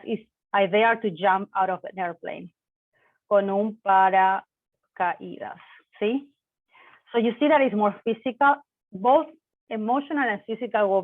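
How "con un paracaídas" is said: "con un paracaídas" said slowly, syllable by syllable; slow model phrase. Shown as a demonstration. Teacher repeating "con un paracaídas" slowly for Spanish learners, slow and clear. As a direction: spell it out slowly for the class. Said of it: "teacher repeats phrase slowly".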